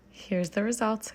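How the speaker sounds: ordinary talk, nothing marked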